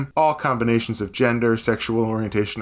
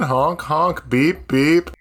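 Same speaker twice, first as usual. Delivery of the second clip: train honks; 3 semitones higher, much slower than usual. Pretend. Imitating train pulling out.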